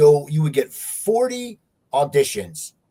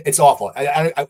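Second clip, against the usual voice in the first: ordinary talk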